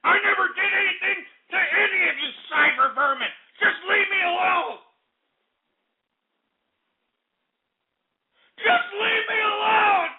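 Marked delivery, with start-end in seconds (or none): 3.6-10.1 tearful, cracking yell, pitched up; sob-shout the plea; real distraught crying plea. Real, not acted. Breaking down, begging.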